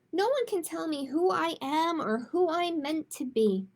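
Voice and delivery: storytime read-aloud voice, gentle and clear; picture book read to kids; reading to children. Acted. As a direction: read it like storytime for little ones. Librarian reads picture book aloud.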